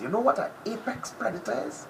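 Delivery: ordinary delivery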